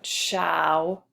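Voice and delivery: "shower" split as SHOW, pause, ER; broken into syllables. Shown as a demonstration. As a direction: draw out the first syllable of "shower", then add ER separately. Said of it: "We break it right there".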